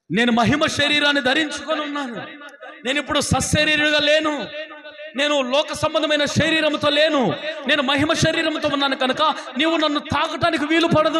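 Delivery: high, ringing proclamation; Jesus's words preached at full force; preaching. Acted. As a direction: proclaim it over the congregation.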